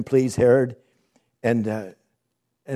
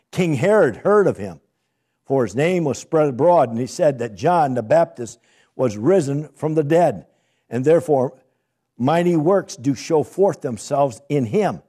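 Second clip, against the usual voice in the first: loud, rising preacher's reading of scripture; booming pulpit reading; 3 semitones higher, 6 dB louder than usual. Acted. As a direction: proclaim the verses to the congregation.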